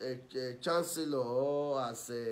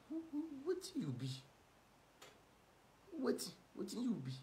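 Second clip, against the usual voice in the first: scornful disbelief; 9 semitones higher, 10 dB quieter than usual. Real, not acted. Mocking scorn at rival.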